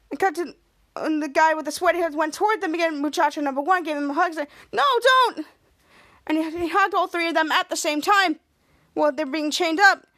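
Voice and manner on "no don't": high acted plea; mock panicked protest. Muchacho facing another hug, high mock plea. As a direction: plead in pretend alarm.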